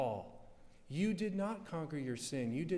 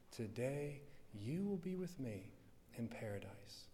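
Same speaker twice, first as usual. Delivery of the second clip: tender hush; 2 semitones lower, 8 dB quieter than usual. Acted. Christ speaks to the thief.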